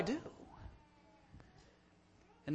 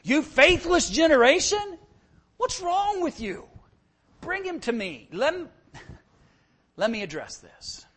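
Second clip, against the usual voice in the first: exasperated rebuke; 8 semitones higher than usual. Shown as a demonstration. Preacher acts Jesus rebuking the faithless crowd. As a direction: let the impatience ring out. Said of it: "read it that way, 'cause that's the emphasis".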